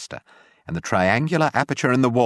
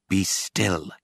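plain reading voice

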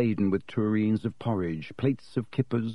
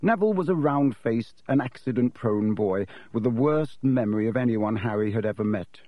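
plain reading voice

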